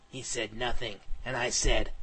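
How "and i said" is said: ordinary delivery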